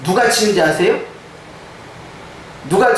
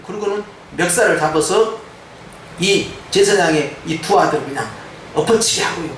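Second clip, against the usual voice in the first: normal talking voice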